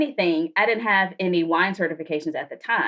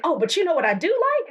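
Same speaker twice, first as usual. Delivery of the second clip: voicing the date, eager and much higher; 10 semitones higher than usual. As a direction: tease it like a big reveal. Date's eager question.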